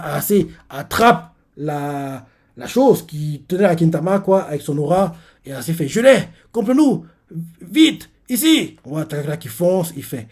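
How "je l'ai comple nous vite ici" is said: cris hauts et forts, pressés; appelle-le à toute voix; appel crié. Retold or imitated. Ayase appelle à l'aide. (Ayase appelle Takakura en criant, plus haut et fort)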